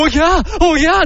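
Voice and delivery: high imitated newborn "ogyaa"; mock well-trained baby wail. Father imagines baby crying after voice lessons, mock wail. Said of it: "泣くときめっちゃ良い声で泣かれても".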